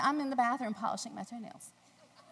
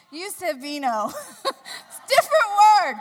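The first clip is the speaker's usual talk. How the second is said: laughing; 10 semitones higher, 14 dB louder than usual. Real, not acted. Laughing at the mixup.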